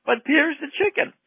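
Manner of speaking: high-pitched mock crowd cry; screaming fans, imitated. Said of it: "screaming that".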